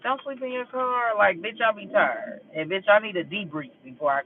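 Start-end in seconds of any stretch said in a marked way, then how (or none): 0.0-1.2 softer, higher mocking quote; lightly put-on scolding woman; mimicked nagging lady. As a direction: mimic a busybody telling you off, high and soft. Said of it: "trying to tell me".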